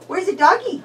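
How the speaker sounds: normal talking voice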